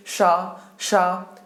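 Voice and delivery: isolated "शा," with voice pushed out on it; voice-backed sha. Shown as a demonstration. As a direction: sound out "शा," on its own. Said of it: "आपको ज्यादा आवाज भी निकालनी पड़ेगी".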